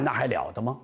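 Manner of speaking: plain reading voice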